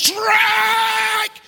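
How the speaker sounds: high, long, loud shouted call; umpire's call. Acted. Preacher imitating an umpire making a bad call, shouting. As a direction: belt it out like an umpire. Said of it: "The umpire makes a bad call".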